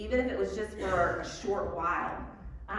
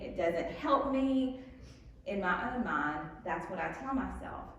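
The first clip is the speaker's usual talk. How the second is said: normal talking voice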